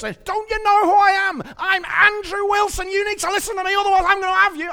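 hammed-up northern accent bully; insecure bully voice. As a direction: demand respect like a puffed-up bully. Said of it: "you're continually trying to force".

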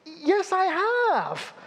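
high, slow, put-on wail of a distraught man; distraught caller. Retelling a panicked phone call, high wailing caller. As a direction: ham up the upset caller's plea.

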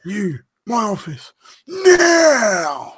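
angry boss. Retold or imitated. Repeating the boss order.

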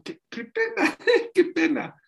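squeaky high, laughing embarrassment; embarrassed friend. Retold or imitated. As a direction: squeal it out, mortified. (amigo avergonzado, voz muy aguda y risa)

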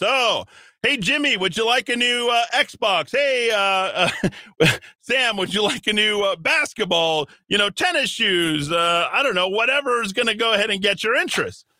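high, eager sugary dad; bribing dad voice. Pretend.

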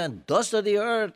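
tearful. Retold or imitated. Worshipper crying out his lowliness in prayer, tearful. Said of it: "and he was crying".

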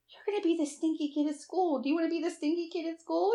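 put-on sing-song threat to a stubborn kid; teasing mom voice. Mom teasing son about showering.

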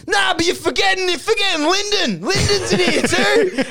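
very high, insistent hollering; excited shout. Acted. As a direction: announce it in hammed-up glee. Acted excited shout in story.

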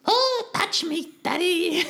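very high, slow mock seductive cat; that voice again. Shown as a demonstration. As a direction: stretch it out, high and teasing. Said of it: "Can you do that voice one more time".

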